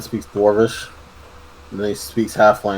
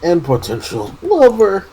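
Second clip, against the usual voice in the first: loud, laughing tease; excited teasing; 11 semitones higher, 4 dB louder than usual. Real, not acted. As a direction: tease them with a big laugh.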